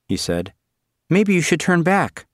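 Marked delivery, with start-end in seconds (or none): 1.1-2.3 put-on high worried girl. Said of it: "Suzanne said".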